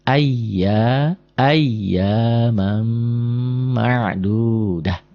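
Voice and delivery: ayyamam ma'dudah, m held into the next word; very slow ayyamam ma'dudah with a long humming m; merged tanwin. Shown as a demonstration. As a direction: stretch ayyamam ma'dudah as one linked phrase.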